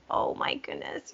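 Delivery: breathy excited whisper. Real, not acted. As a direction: whisper it like a secret. Excited whisper to band directors.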